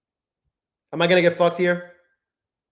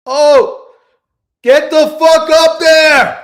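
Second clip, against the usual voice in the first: slow, drawn-out yell, much higher and louder; furious shout; 10 semitones higher, 10 dB louder, much slower than usual. Real, not acted. Trader yelling at the price chart, slow furious shout. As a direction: roar at the chart to climb.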